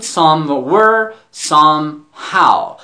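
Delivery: somewhere, somehow said very slowly, part by part; careful slow demonstration. Shown as a demonstration. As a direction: draw out somewhere and somehow for learners. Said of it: "پس خوب دقت کنید". (somewhere و somehow خیلی آهسته و شمرده)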